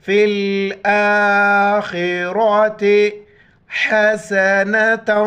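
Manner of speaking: आखिरति with a throaty, full kha and heavy ra, slow and drawn. Shown as a demonstration. Teacher modelling heavy letters, slow melodic recitation. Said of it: "खा और रा मोटे अल्फाज में से मोटा करके पढ़ेंगे".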